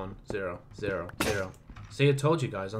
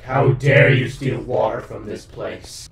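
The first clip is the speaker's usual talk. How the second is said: slow, loud, booming accusation; hammed-up slow indignation; mock angry place; 3 semitones lower, 5 dB louder, slower than usual. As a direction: voice the place's slow, solemn outrage. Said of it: "It's like".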